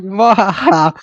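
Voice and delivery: mad scientist. Pretend. Maniacal laugh after bloodthirsty line.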